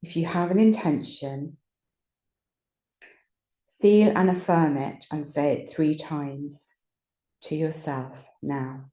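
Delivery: calm guided relaxation with long pauses; unhurried meditation voice, leaving space between phrases. Real, not acted. Teacher settling listeners into rest and ease, guided meditation voice.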